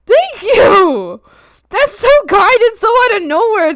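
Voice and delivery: high, loud gushing gratitude; overwhelmed thanks. Real, not acted. Streamer thanks Winter for gifted subs, excited and overwhelmed. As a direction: gush loudly, bowled over by the gift. Surprise gift of seven subs.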